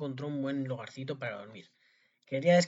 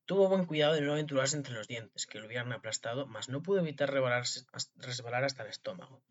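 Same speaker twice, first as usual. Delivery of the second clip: usual speaking voice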